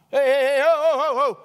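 loud, high hey hey ho to halt a crowd; stopping shout. Acted. Jesus stopping the pressing crowd, loud high call. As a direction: call out big to make everyone freeze.

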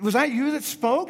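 high-pitched, amazed question; incredulous burglar. Acted. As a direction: act out a burglar stunned by a talking parrot.

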